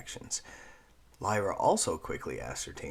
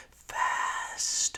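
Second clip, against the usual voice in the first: urging whisper. Acted. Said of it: "the voice urged".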